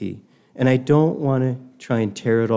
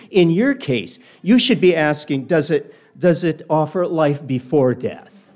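mildly condescending retort, higher in pitch; 4 semitones higher, 4 dB louder than usual. Retold or imitated. Cartoon cat answers his literal-minded companion, mildly condescending. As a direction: put him down as a cartoon cat would, lightly. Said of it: "he's very condescending of Satchel".

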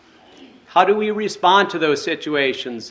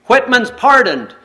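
higher, louder announcement; excited call; 7 semitones higher, 5 dB louder than usual. Acted. Miller holding up the pardon to the soldiers, excited call. Calling out to execution party.

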